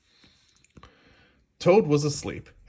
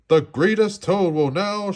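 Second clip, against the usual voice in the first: announcer voice; 8 semitones higher, 5 dB louder than usual. A narrator's reading. The strange voice announcing.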